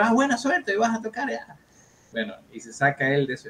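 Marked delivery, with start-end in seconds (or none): 0.0-1.6 much higher, eager voice; hammed-up awed young guitarist; starstruck self. Young guitarist wishing the star's guitarist luck, much higher.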